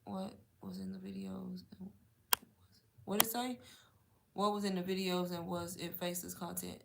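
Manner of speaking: very soft, half-to-self reading; reading to oneself. Real, not acted. Reading a viewer's question about the videos, quietly to oneself. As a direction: quietly read it out, figuring out the words.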